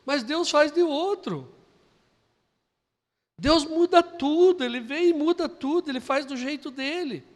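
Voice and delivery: high, loud, impassioned preaching; preaching at full force. Acted. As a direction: lift the congregation with rising fervor. Preaching climax.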